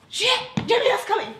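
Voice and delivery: high, loud alarm, acted out; mock-panicked heads-up to a housemate; panicked warning. Voices friend warning she is coming.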